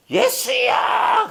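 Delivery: high, drawn-out shout to Jesus; a knocked-down believer shouting for Jesus; desperate cry. Retold or imitated. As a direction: cry out from the floor, stretched long. Falling and crying out to Jesus.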